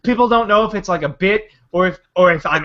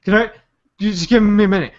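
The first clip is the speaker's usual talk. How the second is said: a touch of breathless pleading; mock winded. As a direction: act wiped out mid scare. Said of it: "and then go".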